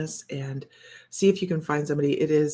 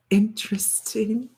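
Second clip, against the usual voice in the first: whisper; 6 semitones higher, slower than usual. Real, not acted. Medium murmuring reaction to vision.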